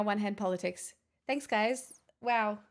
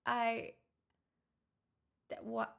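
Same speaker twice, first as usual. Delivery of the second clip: usual speaking voice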